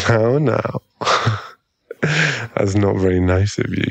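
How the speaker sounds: ordinary delivery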